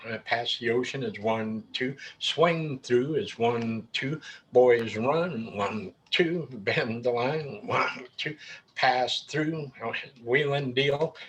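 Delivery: slow, beat-by-beat call with counts; counting beats. Shown as a demonstration. Caller counting the beats for new callers, slow and even. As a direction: tap out each call on the two beats, slowly. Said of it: "delivered in two beats using both the downbeats".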